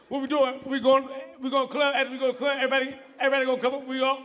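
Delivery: put-on high, loud slurry drunk babble; blackout drunk. Blacked-out drunk asking where the club is, slurred high babble. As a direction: ramble like you are blacked out.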